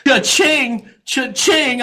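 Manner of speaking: high, ringing "cha-ching"; cash-register imitation. Imitates cash register as tests add up.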